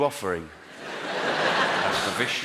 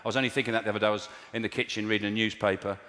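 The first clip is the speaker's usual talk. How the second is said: ordinary talk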